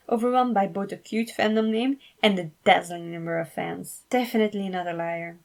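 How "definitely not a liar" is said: usual speaking voice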